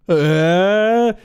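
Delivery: loud, high mock shriek; a big horrified mirror-moment scream, hammed up. Shown as a demonstration. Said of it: "not sort of like a big".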